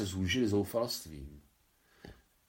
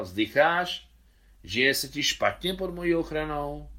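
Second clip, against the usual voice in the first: raised, louder, hectoring shout; drunken roar; 6 semitones higher, 4 dB louder than usual. Acted. Drunk father roars at his sighing wife. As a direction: bellow it at her like a drunk. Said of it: "řval".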